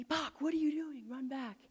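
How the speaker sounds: lightly voiced teammates calling her back; teammates yelling. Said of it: "My teammates are yelling at me".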